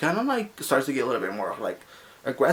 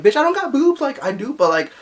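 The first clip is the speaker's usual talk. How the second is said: much higher and louder, outraged; indignant outburst; 8 semitones higher, 5 dB louder than usual. Retold or imitated. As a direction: blurt it out in shocked protest. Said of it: "and I'm like".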